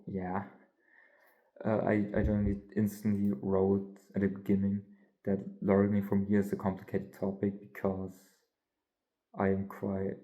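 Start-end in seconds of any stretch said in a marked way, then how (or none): none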